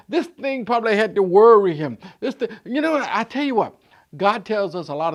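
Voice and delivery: loud rising preacher's call; full-force preaching. Acted. Preacher building to a shout for the church. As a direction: preach it out to the congregation.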